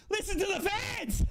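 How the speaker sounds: high, stretched-out cry of joy; elated shout. Real, not acted. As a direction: revel in the crowd's noise. Revelling in the crowd after goal.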